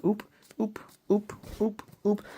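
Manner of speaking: high squeaky little yelps; startled squeaks. Retold or imitated. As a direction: yelp it high and quick. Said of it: "I'm like".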